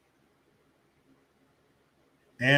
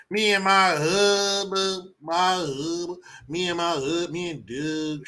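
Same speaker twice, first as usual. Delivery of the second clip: mock Kamala; 5 semitones higher than usual. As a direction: stumble over my hub like a bad actress. Mocking Kamala again.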